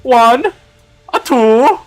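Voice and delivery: high, loud count; excited countdown. Acted. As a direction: call the count out high and eager. Characters counting before objecting.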